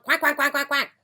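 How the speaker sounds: high, frantic duck quacks; rapid string of high quacks; quacking ducks. Acted. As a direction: keep quacking over the traffic. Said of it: "kept right on quack".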